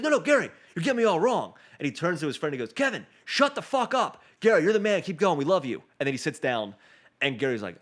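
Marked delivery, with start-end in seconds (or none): 0.0-5.8 high, loud, hurried reassurance; eager soldier backpedaling. Retold or imitated. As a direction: gush at Gary to calm him down. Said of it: "He's like".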